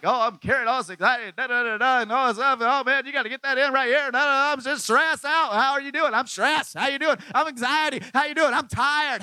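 high, loud whiny moaning; mock the stressed-out churchgoer, loud and high; mocking stressed Christians. Pastor mocking stressed believers, high loud whine. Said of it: "they're like".

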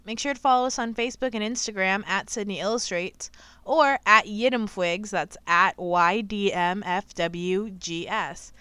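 loud announcer sign-off; podcast outro read. Acted. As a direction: read the plugs like an ad.

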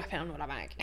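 low, hushed, furtive voice; mock drug dealer. Teasing friend about tea bags, furtive dealer voice. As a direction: tease it back low and under the breath. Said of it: "You sound like a drug dealer".

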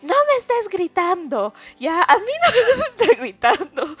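high, loud mock-outraged shout; imitated angry yelling. Mom rebuking her daughter for answering, imitated shout. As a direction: yell it like an indignant mother. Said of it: "me estaba gritando".

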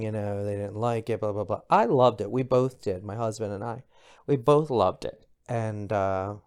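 usual speaking voice

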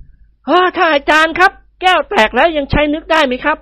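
high, anxious question to the teacher; worried young man. A narrator's reading. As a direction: ask in dismay. Nikorn asks the yogi, worried. (นิกรถามอาจารย์อย่างใจหาย)

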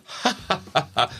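light breathy ha ha from the heart; demonstrating a heart laugh. Shown as a demonstration. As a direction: laugh up from the chest. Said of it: "which is up here and from our heart".

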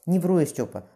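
loud, tearful plea; crying plea. A narrator's reading. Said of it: "Окольничий заплакал".

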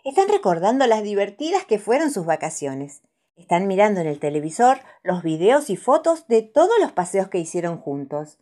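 ordinary delivery

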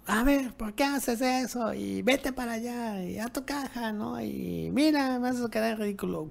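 high, strained mock scolding; high-pitched scolding of a pet in front of a guest; scolding the dog. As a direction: tell the dog off, embarrassed.

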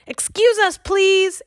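high, loud call into the hill. A narrator's reading. Annie calling to the fairy king and queen, loud. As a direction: call politely across the room. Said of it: "Annie called".

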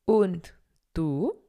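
usual speaking voice

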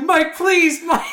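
very high loud begging, half laughed; pleading girlfriend. Retold or imitated. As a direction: plead with rising panic. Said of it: "And she's like".

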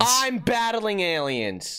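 high, drawn-out stubborn declaration; defiant insistence. Pretend. Podcaster contradicting Jimmy's peaceful plan, defiant. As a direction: insist like an obstinate kid.

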